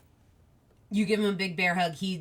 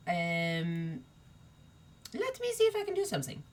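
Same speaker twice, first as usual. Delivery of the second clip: Chess; 2 semitones lower, 7 dB quieter than usual. Acted. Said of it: "you see Chess goes".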